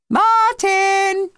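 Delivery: high, loud call across the beach; mother calling her son, loud and high. A narrator's reading. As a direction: shout his name into the distance.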